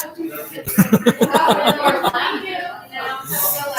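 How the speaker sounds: long high-pitched laugh. Real, not acted. Streamer tickled by the Long Island accent, laughing. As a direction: laugh on and on, helplessly amused.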